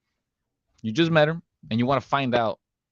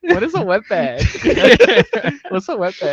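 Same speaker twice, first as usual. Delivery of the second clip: much higher, louder, cracking up; laughing; 11 dB louder than usual. Real, not acted. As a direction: ask through a burst of laughter. Asks with a laugh.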